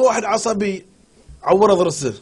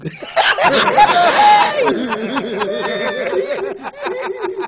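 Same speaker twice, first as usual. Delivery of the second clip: high laughter. Real, not acted. Laughing at joke.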